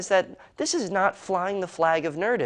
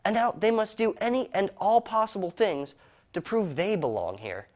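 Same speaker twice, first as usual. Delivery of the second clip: normal talking voice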